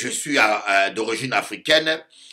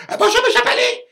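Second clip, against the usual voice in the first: much higher, put-on sugary greeting voice; mock polite greeting; 6 dB louder, faster than usual. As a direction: chirp it out like a fussy polite gent. Husband greeting, mimicked politely.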